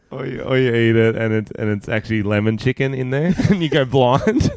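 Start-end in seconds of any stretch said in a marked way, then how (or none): none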